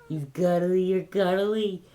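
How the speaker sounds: high, loud gushing at a pet. Real, not acted. Vlogger gushing at the cat held in arms, loud baby talk.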